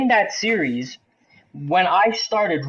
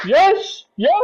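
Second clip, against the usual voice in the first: high, loud imitation of a gloating fan; celebrating shout; 9 semitones higher, 6 dB louder than usual. Detroit fan beside him cheers first goal, celebrating shout. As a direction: leap up and crow it, high and loud.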